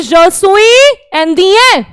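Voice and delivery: Je suis indien drawn out very slowly; slow French demo. Shown as a demonstration. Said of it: "मेल्स क्या बोलेंगे".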